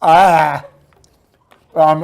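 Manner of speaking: ordinary talk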